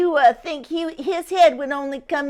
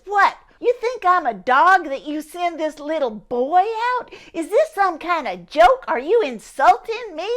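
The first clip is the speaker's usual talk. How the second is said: high, loud, outraged bellow; an insulted giant roaring at the army; furious, offended giant shouting down; angry giant; 4 semitones higher, 4 dB louder than usual. Acted. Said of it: "But he's angry".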